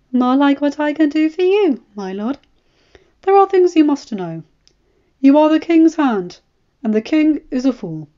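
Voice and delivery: lightly voiced eunuch spymaster, grave; Varys warning. A narrator's reading. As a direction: lay out the danger like a sly spider.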